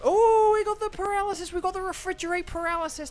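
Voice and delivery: high, loud, delighted squeal; excited. Real, not acted. Thrilled at the paralysis proc.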